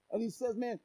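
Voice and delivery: normal talking voice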